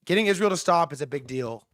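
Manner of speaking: normal talking voice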